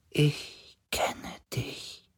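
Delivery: slow, high, whispery spirit; weak dying voice. A narrator's reading. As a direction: breathe it out faintly, like a dying being. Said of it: "aber sie klang so schwach". (schwache, sterbende Geisterstimme, gehaucht)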